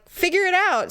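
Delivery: high, curt demand; bossy order. Retold or imitated. Wife telling husband to deal with it, high and bossy. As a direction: dismiss his excuse.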